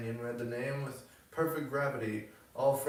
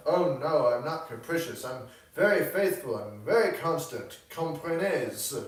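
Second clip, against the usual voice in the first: emphatic, higher and louder mademoiselle; louder, insistent character voice; protesting young Frenchwoman; 4 semitones higher, 7 dB louder than usual. A narrator's reading. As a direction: protest brightly as the French painter.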